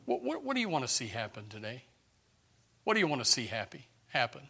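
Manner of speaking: normal talking voice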